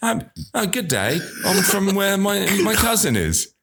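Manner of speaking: high-pitched, tentative mock Australian; Australian accent. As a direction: put on a halting Australian greeting. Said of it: "Isn't Sandy Australian?".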